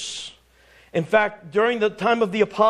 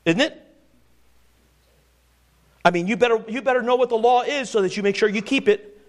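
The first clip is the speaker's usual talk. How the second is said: ordinary talk, nothing marked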